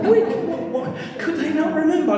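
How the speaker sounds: high, puzzled mock fluster; comic bewildered questioning; baffled disbelief. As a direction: act out the muddled writers scratching their heads. Disciples forgetting who was there.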